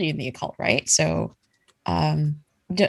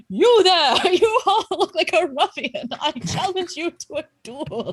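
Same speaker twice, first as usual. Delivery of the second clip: high, wavering ghost challenge; ghostly voice; 9 semitones higher than usual. Acted. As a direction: wail the challenge like a pompous phantom. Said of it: "you hear a ghostly voice say".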